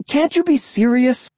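high, loud shrill plea; shrill cry. A narrator's reading. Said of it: "I cried shrilly".

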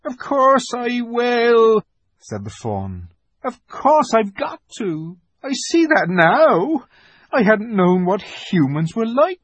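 high faun voice, slow and earnest; a faun resolving to do right. A narrator's reading. Faun decides to help.

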